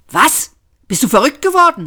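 high, fast indignant question; incredulous outburst. A narrator's reading. Crew member answers Arthur's risky idea, incredulous outburst. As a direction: react as if the idea were madness.

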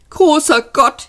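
high, despairing moan; exasperated high lament; wailing. A narrator's reading. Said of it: "jammerte Arthur".

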